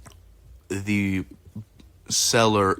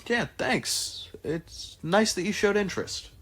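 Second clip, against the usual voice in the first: high, polite thanks; Ajax's voice; 10 semitones higher, 3 dB quieter than usual. Acted. Ajax thanking the party, high and polite. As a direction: lift the voice high and thank them.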